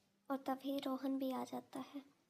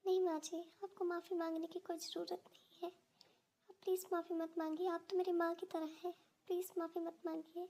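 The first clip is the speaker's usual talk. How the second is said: put-on higher, timid young wife's voice; meek daughter-in-law; 4 semitones higher than usual. Daughter-in-law timidly telling her mother-in-law she is sick. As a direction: plead weakly that she is unwell.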